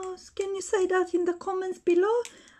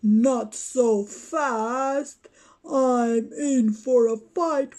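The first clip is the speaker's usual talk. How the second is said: much lower, gruff tough-guy boasting; toy Batman voice; 6 semitones lower, 3 dB louder, slower than usual. Pretend. Batman taunting the other toys, deep boastful voice. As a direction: play the bully hero claiming his spot.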